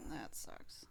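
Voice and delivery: whispered, deflated reaction. Real, not acted. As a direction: breathe it out almost silently. Quiet reaction to the death.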